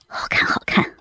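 breathless, quiet, unvoiced; hushed eager whisper. A narrator's reading. Son answers eagerly, hushed.

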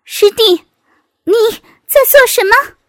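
high, loud startled challenge; sudden high-pitched scolding shout; sharp feminine shout. A narrator's reading. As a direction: cry out sharply in a high woman's voice. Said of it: "突然一声娇喝".

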